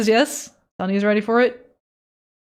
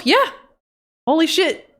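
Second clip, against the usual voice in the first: ordinary talk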